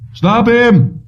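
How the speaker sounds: high, urgent shouted command; guard's shout. Acted. Guard yelling at escaping Jennings, urgent shout. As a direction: yell it to stop the runaway.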